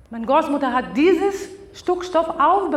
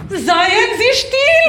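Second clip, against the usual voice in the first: slow furious yell; 7 semitones higher, 6 dB louder, much slower than usual. Acted. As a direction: bellow at her, drawn out. Besessene faucht den Geist an. (langsam und laut schreiend)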